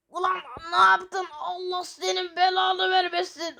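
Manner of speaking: shouted curse at the friend; angry shouting. Acted. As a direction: yell at him for what he did. Friend killed, angry outburst.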